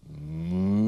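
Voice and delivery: long, drawn-out moo; cow moo. Shown as a demonstration. Teacher showing children how cows go, cow moo. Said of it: "Cows go".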